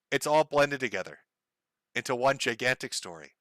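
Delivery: normal talking voice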